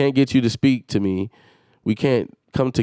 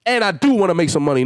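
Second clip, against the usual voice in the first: ordinary delivery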